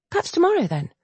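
high, hopeful coaxing; lightly voiced heroine. Governess coaxing the duke's heir, high and hopeful. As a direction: offer it gently.

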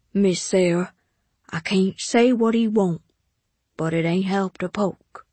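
maid with a Southern dialect, subdued; subdued household maid; maid's voice. A narrator's reading. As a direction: speak as the maid, guarded and sympathetic.

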